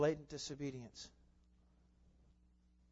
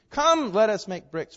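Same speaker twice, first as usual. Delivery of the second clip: slow, loud grand call; parroting builders; 7 semitones higher, 11 dB louder, slower than usual. Retold or imitated. Preacher voices Babel's builders, slow and grand. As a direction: proclaim slowly and loudly. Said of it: "parroting God".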